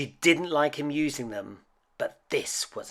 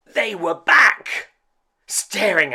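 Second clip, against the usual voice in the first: dramatic reveal; 9 semitones higher, 10 dB louder than usual. A narrator's reading. Scary reveal.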